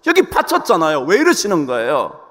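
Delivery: indignant complaint to God, pitched high; acted angry protest. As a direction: protest like a wronged worshipper. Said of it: "막 화를 내는 거".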